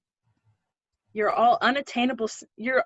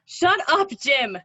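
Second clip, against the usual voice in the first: sharp, louder shut-up; snapping; 3 semitones higher, 5 dB louder, slower than usual. Acted. She silences Jim's accusation with a sharp, loud snap. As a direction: cut him off hard. Cutting him off in anger.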